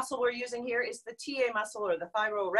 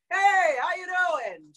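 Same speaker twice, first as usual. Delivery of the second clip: calling out; 5 semitones higher, 5 dB louder, much slower than usual. Shown as a demonstration. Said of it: "call out to your neighbor".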